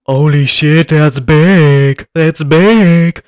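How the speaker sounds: loud, put-on awed character voice; imitating Modok. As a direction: gasp it out loudly in a silly voice. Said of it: "modok's like".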